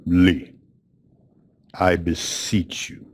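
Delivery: grave, slow plea with long pauses; real somber. Retold or imitated. Elderly man pleading with his guest at parting, somber. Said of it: "he gets real somber".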